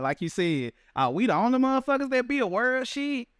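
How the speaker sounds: ordinary talk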